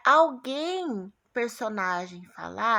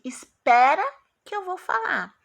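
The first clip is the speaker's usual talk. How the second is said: "Espera" loud with a rise, then a pause, "que eu vou falar" higher; hold-on announcement; 6 semitones higher, 6 dB louder than usual. Shown as a demonstration. Teacher acting out what a colon says, suspenseful announcing. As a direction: make them wait after "Espera". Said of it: "o dois pontos é como assim".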